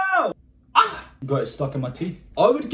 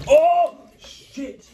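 high, loud, laughing shock; startled laughing curse; 13 semitones higher, 5 dB louder than usual. Real, not acted. Spilling water on the table, he curses through a startled laugh. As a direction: caught off guard by the spill.

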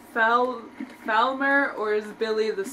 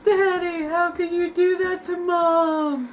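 son's voice; 5 semitones higher, slower than usual. Pretend. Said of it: "I'm the voice of the son".

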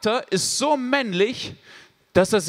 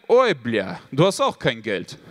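put-on low, gruff Russian-accented beggar, lower pitch; 10 semitones lower than usual. Father's joke, beggar finds empty pocket, low Russian-accented grumble. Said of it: "und merken".

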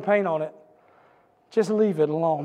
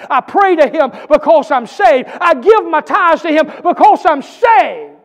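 litany rising steadily louder and higher; building preaching; 3 semitones higher, 7 dB louder than usual. Acted. Pastor drives home why he serves, preaching harder. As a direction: build each line toward a shout.

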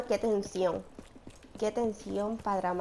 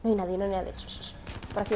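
normal talking voice

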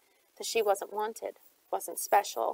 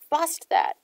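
loud order; 7 semitones higher, 5 dB louder than usual. A narrator's reading. Shade orders Eel.

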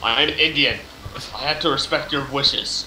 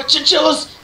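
alarmed; 9 semitones higher than usual. Real, not acted. Alarmed cries.

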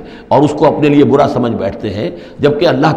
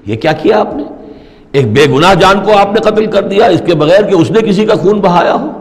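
shocked protest; 6 semitones higher than usual. Retold or imitated. Moses protesting the killing of a boy, outraged and raised. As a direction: voice Moses objecting in alarm, raised up high. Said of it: "حضرت موسیٰ علیہ السلام پھر بولے".

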